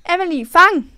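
shouted call across the schoolyard. Acted. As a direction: yell it to a friend. Bully calls out throwing kippa.

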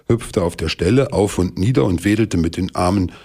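usual speaking voice

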